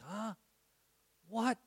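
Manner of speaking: put-on a stunned, loud "Huh? What?" at the girl's news; shocked double take. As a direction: blurt it out in disbelief. Double take at her healing report.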